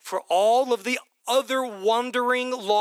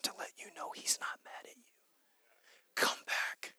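breathy hushed plea; whisper; 15 dB quieter than usual. Acted. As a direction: whisper it as a tender call home. Whispered invitation to come back.

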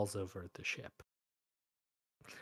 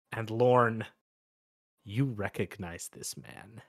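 ordinary talk, nothing marked

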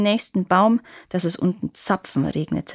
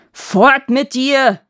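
roaring grunting brute; 6 semitones higher, 8 dB louder, much slower than usual. Acted. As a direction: bellow it like an angry giant. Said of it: "brüllte der Riese".

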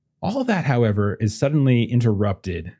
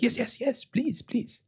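high, soft, repeated eager pleading; 14 semitones higher, 7 dB quieter, slower than usual. Acted. French parents begging to see the plans, very eager. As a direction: beg eagerly in a small high voice. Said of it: "they both very eager".

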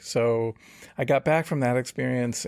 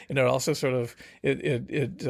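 ordinary delivery

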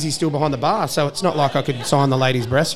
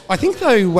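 ordinary talk

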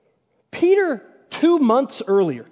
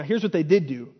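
ordinary delivery

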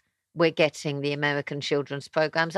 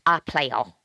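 "iPlayer" lightly put on in a London youth accent; Mixed London English; 2 semitones lower than usual. Host imitating the app's advert, "iPlayer" in Mixed London English. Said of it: "and the advert for it is".

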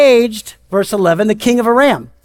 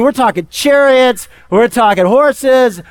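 usual speaking voice

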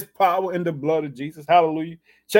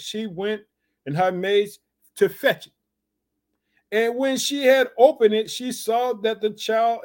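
lifted, measured Bible reading; scripture reading; 4 semitones higher than usual. Acted. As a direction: read the story out with rising weight. Preacher reads on in scripture.

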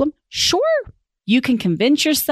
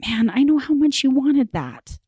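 higher, tender sympathy; sincere empathy; 7 semitones higher than usual. Shown as a demonstration. Parent consoling a disappointed child, sincere empathy. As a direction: feel for them sincerely. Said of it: "It is all in your tone".

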